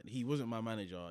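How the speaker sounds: ordinary talk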